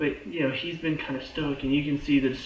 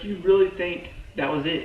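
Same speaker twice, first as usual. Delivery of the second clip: ordinary talk, nothing marked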